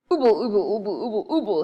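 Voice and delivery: lightly voiced earnest mumbling; a mild earnest ooble through toffee; earnest toffee mumble. Moonface earnestly tries to explain through a mouthful of toffee. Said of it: "he said very earnestly".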